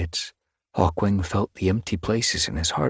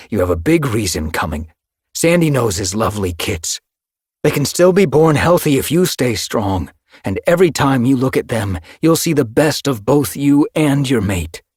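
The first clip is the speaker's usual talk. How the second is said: louder, pleading to keep her going; urgent encouragement; 7 semitones higher, 7 dB louder than usual. Acted. Hawkwing urging the grieving queen, louder and pleading. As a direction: push her to hold on, rising in force.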